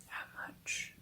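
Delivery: barely a dry whisper; just shape the words with no voice; breathless whisper. A narrator's reading. Stunned father asking the visitor the sum, whispered. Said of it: "His dry lips shaped the words".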